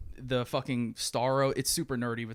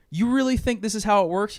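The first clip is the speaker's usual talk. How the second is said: higher, mock-challenging hero retort; defiant hero; 8 semitones higher, 4 dB louder than usual. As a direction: throw it back at the beaten villains. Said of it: "he's just like".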